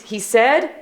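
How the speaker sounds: ordinary delivery, nothing marked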